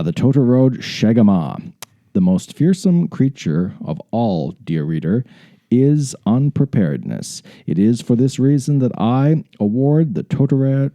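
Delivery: measured, deliberate read-aloud; dramatic story narration. Acted. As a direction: narrate it like a grand adventurer's memoir.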